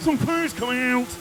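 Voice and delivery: high squeezed whine; mock strained squeal. Kart driver answering the commentary box, high strained squeal.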